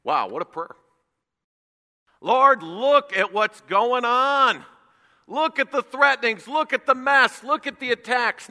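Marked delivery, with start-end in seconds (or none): 2.2-8.5 high, loud pleading to the Lord; impassioned plea. Acted. Preacher voicing the church crying to the Lord. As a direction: cry out to God at full force.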